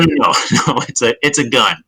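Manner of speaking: usual speaking voice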